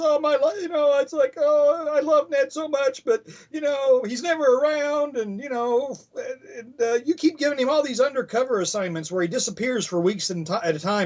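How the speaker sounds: put-on high girlish voice, a lonely wife complaining; Betty's voice. Betty pouring out her worries to Robbie, high and girlish. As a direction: go way up and play the neglected wife. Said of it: "and Betty says".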